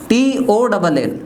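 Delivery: letters spelled out slowly; slow spelling. Shown as a demonstration. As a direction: dictate each letter slowly. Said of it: "अब आता है टॉल". (धीमे-धीमे spelling)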